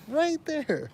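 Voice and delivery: high, drawn-out exasperation; exasperated. Real, not acted. Complaining how near the Wi-Fi is, exasperated. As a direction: stretch it out high in disbelief.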